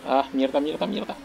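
exasperated, rising curses; frustrated cursing. Real, not acted. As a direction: swear in frustration under your breath. Tires went cold, swearing.

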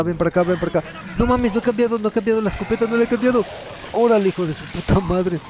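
high, urgent frantic calls; scrambling mid-fight; high panicked hurry. Real, not acted. Frantic firefight, hunting enemy.

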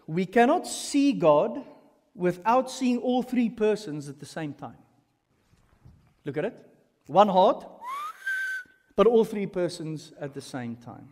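ordinary delivery

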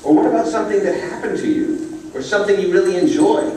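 a bright little bird explaining to a puppy; little yellow bird. Acted. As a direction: chirp it out like a cheerful teacher bird. Bird keeps teaching Rocket.

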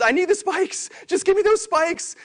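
very high, pleading whine; desperate craving. Pretend. Marketer begging for spikes in the numbers, a high desperate whine. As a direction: beg like an addict for numbers.

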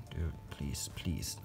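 soft whispered plea to the spider; pleading whisper. Real, not acted. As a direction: whisper so the spider does not bolt. Begging the spider not to bolt.

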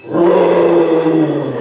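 loud, low mock roar; lion roar. Lion in the cage greets the kids with a loud hammed-up roar. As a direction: roar out like a lion saying hello. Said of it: "and it says".

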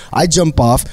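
ordinary talk

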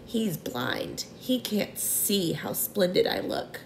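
soft whispered aside; whispering. A narrator's reading. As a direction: whisper it into his ear. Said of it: "whispering in James' ears".

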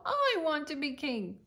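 slow, loud, high whining wail; whiny tantrum. Acted. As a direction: sob it out like a spoilt child. Archduke tantrum.

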